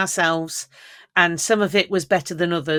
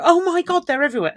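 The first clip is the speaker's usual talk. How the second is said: imitating shock; 11 semitones higher, faster than usual. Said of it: "they go".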